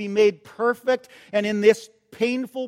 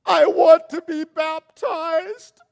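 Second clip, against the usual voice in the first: slow, higher and louder, near tears; tearful confession; 8 semitones higher, 6 dB louder, slower than usual. Retold or imitated. Shy Gail telling her pastor, choked and tearful. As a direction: blurt it out through tears. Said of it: "And she said".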